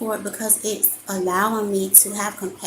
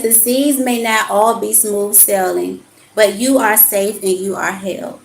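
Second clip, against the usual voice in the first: measured, emphatic reading of card text; 5 dB louder than usual. Acted. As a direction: read the card like a gentle sermon. Reads oracle card aloud.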